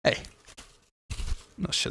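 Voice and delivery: ordinary talk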